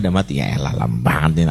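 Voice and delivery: low, imitated grumble; grumbling. Congregant complaining about a long recitation, low grumbling. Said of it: "udah gerundel tuh".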